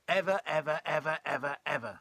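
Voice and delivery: EVER hammered out loud and high, easing off at the end; insistent repetition. A narrator's reading. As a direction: punch each EVER in exasperation. George retorts with insistent repetition.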